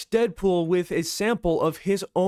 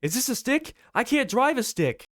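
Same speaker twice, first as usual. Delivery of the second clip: higher-pitched, caught off guard; dismayed surprise; 7 semitones higher than usual. Acted. Wade suddenly finding a stick shift, comically dismayed. Wade discovers the car's manual gearbox.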